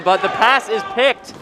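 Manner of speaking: high, loud rapid-fire game call; excited play-by-play. Real, not acted. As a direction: call the fast break live. Live play-by-play of a fast break.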